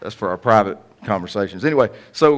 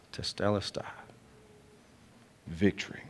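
hushed Greek word; 3 semitones lower, 14 dB quieter than usual. Shown as a demonstration. Repeats Greek word, hushed and solemn.

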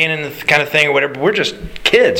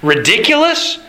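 usual speaking voice

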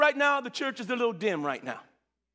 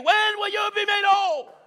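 higher, drawn-out calling question; enthusiastic preacher version; 9 semitones higher, 3 dB louder, slower than usual. Retold or imitated. Preacher voicing Daniel's question to the congregation. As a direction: ask it with his own fire. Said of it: "he may not say it like I say it".

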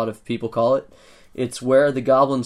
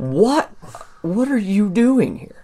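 incredulous dwarf clerk; 9 semitones higher, slower than usual. Acted.

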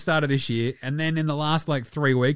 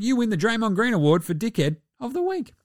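ordinary talk

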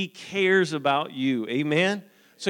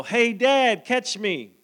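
loud, high call from above; child yelling; 9 semitones higher, 9 dB louder, slower than usual. Retold or imitated. Son on the cliff calling to his dad, loud and high. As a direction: shout down to dad like an excited boy. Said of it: "above me yell".

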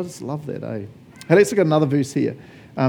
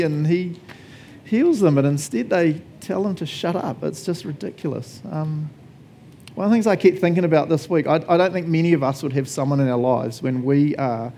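normal talking voice

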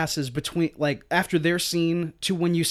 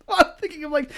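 laughing; 9 semitones higher than usual. Real, not acted. Cracking up at own joke.

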